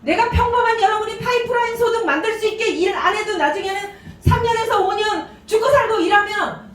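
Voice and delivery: high-pitched, impassioned mock rant; exasperated company chairman. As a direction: imitate a boss pleading in frustration. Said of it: "우리 회장님이 딱 그러시더라고요". (답답해서 열변 토하는 회장님 흉내)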